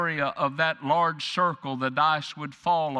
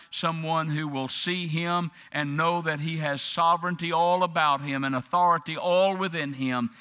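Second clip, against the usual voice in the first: ordinary delivery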